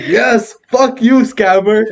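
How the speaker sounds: hammed-up gloating; mock triumphant yell. Victim taunting the scammer, gleeful high voice.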